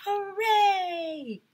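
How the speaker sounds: high squealing hooray; cheering. A narrator's reading. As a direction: cheer like a whole class of kids. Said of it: "yelled when we saw her".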